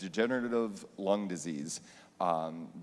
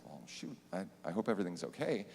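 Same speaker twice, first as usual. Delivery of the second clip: worried hush; 7 dB quieter, faster than usual. Retold or imitated. Teacher seeing the parents' number at night, hushed worry. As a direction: dread the late-night call, almost whispering.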